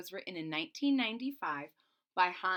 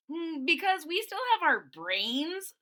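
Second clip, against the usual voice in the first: higher, louder, cheeky little dinosaur; defiant Boland; 7 semitones higher, 6 dB louder than usual. Acted. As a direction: talk back with spirit. Boland answers the bully.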